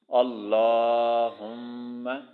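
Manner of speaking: अल्लाहुम्मा with a thick, heavy lam, slow; अल्लाहुम्मा, heavy velarized lam drawn out. Shown as a demonstration. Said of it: "इसको मोटा पढ़ना है".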